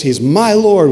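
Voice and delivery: "He's my Lord" with "Lord" hit high and loud; emphatic. Shown as a demonstration. Pastor showing the emphatic declaration, "Lord" stressed high and loud. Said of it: "with em-, emphasis".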